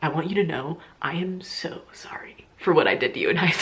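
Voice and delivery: whispered, secretive, a little slurred; drunken hushed apology. Retold or imitated. The drunk guy pulling her aside to apologize, hushed. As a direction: mimic a drunk guy confessing close up. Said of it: "his drunken ... state and was like".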